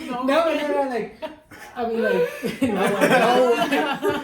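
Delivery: loud, high, laughing objection; laughing protest. Real, not acted. Flustered protest while friends tease him.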